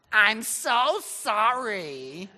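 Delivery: put-on loud, high, drawn-out phony American accent; over-acted American accent, stretching every word out. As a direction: ham it up in a really fake American accent. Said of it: "talking in some really phony American accent".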